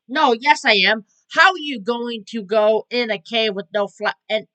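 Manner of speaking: loud, baffled protest that breaks off; exasperated disbelief. Real, not acted. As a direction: demand an answer, then give up mid-word. Exasperated, cuts off own question.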